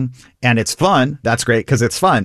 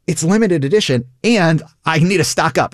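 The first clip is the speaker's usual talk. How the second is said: higher-pitched, playful urgency; acted excitement; 6 semitones higher than usual. Improviser saying yes-and to a partner, mock excitement. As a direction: react as if it's thrilling news.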